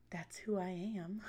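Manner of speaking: breathy whispered aside. Real, not acted. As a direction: drop to a whisper. Whispered aside after outspoken.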